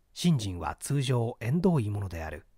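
plain reading voice

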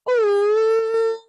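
high, loud hooting train-whistle cry. Acted. Child pretending to be a railway engine, whistle hoot. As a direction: let out a shrill engine whistle.

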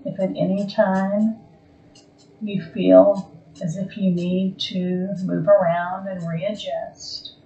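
slow, calm meditation voice with long pauses; guided meditation. Acted. Yoga teacher guiding a resting class into Yoga Nidra, calm guided meditation. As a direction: ease them gently toward stillness, phrase by phrase.

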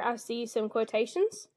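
ordinary talk, nothing marked

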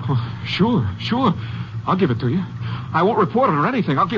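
high, nervous, eager to please; frightened pleading. Acted. Salesman pleading with the hitchhiker holding a gun, frightened. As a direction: placate the gunman, voice climbing.

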